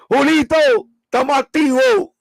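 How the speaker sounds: high, hyped call to the chat; excited shout-out. Real, not acted.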